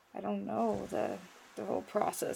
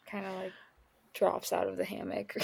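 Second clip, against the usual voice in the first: ordinary delivery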